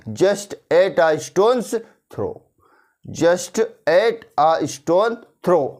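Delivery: very slow, carefully spaced; deliberate teacher drill; slow model answer. Shown as a demonstration. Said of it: "किस प्रकार से systematic ढंग से बोलना है".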